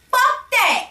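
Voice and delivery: high, squeaky impression of a defiant girl; imitating Red. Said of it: "she was like".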